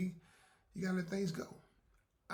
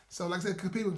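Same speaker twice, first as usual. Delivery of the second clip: ordinary talk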